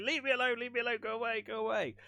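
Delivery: high-pitched panicky jumper, imitated; mock freak-out. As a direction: pretend panic, voice pitched right up. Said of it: "freaking out immediately".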